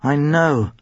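usual speaking voice

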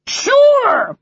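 very high, loud squawk; parrot mimicking. Parrot echoing the boy to his father, high squawk. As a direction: squawk the word back sharply. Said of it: "said Madison".

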